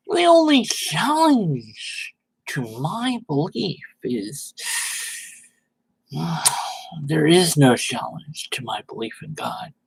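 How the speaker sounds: high, sanctimonious mock voice with a sigh; mock pompous believer. Mocking Red Dead's tweet in a high pompous voice. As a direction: ham up the smug preacher.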